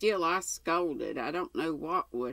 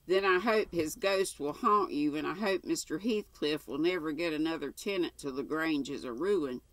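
plain reading voice

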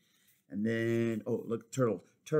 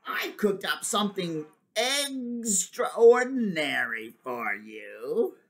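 sly villain egg; 4 semitones higher, 4 dB louder than usual. Acted. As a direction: lure Waffle sweetly toward trouble. Scheming egg lures Waffle.

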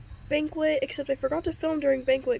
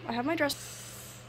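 "I have my dress" slow and deliberate; slow careful redo after stopping herself; slower retake; 9 dB quieter, slower than usual. Real, not acted. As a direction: restart the line, much slower. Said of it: "oh wait, stop, stop".

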